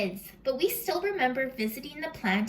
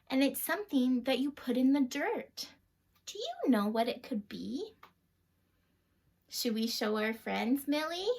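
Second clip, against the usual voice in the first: ordinary delivery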